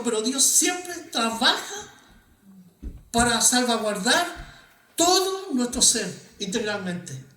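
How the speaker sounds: building sermon climax; passionate preaching. Acted. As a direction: preach it out to the congregation with fire. Preacher rising to full force.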